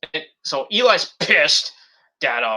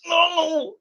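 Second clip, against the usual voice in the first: high anguished cry; 11 semitones higher than usual. Real, not acted. Cringing at the makeover.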